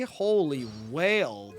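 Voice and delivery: ordinary talk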